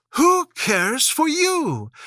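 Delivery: high, slow, scornful; scornful Alice. A narrator's reading. Alice grown tall defying the court, slow scorn. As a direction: defy them, unafraid. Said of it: "grown to her full size".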